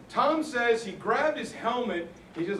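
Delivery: ordinary talk, nothing marked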